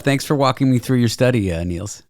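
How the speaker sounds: ordinary delivery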